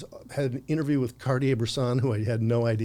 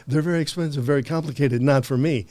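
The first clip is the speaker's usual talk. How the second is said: higher and louder, parroting the shopkeeper; mock echo of the salesman; 4 semitones higher, 8 dB louder than usual. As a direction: throw his line back in a loud, raised mock version. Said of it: "I go, "Yeah, I have thought about 'em".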